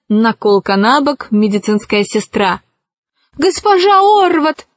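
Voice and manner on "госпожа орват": high-pitched urgent call; raised, high voice calling for help; urgent cry. A narrator's reading. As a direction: cry out to summon her in alarm. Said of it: "закричала она".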